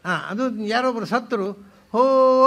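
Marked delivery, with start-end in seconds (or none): 1.9-2.4 loud mock cry of grief; imitated wail. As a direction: cry out loudly, imitating a mourner. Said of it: "ಅಂತ ಕೂಗುದು ಯಾಕೆ". (ಜೋರಾಗಿ ಅಳುವಂತೆ ಅಣಕು ಕೂಗು)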